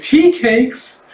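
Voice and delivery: put-on high, surprised little-girl question; excited child. Child hearing of tea cakes, excited high squeak. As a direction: perk right up, pitch jumping.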